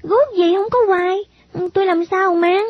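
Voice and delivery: put-on high-pitched child's objection; puzzled little girl. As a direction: question it in a high girlish voice. Girl puzzled by odd clogs.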